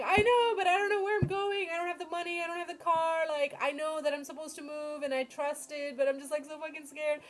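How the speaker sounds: high-pitched whiny pleading; imitated tearful panic. Retelling her pleading to God, tearful and scared. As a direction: pour it out in a re-enacted crying whine, easing down gradually. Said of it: "I'm sitting there crying".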